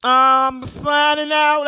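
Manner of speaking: drawn-out, slow sign-off; slow signing-off announcement. Real, not acted. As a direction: stretch the goodbye out. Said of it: "that's enough right now for me".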